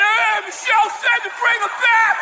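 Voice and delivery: high, strained comic holler; mock holler. Comedian with mic stand confronting captors, high mock holler. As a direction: call it out like a hopeless rescuer.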